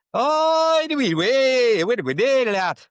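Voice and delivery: loud, high-pitched mock Scottish gabble; broad Scottish accent. Edinburgh local repeating directions to a baffled visitor, in loud broad Scottish. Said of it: "he was as broad an accent".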